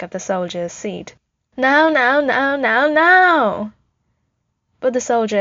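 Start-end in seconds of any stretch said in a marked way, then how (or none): 1.6-3.7 loud, high chant swelling to a long last "now"; screamed tantrum. A narrator's reading. Tony beats the soldier's seat, screaming in a high, loud tantrum. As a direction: pound out each word like a child beating fists. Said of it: "he screams".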